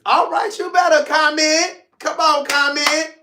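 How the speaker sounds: high, loud teasing order to the viewers; playful demand. Real, not acted. Host urging his viewers to comment, high and loud. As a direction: egg the viewers on to comment, loudly. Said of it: "urges viewers to comment".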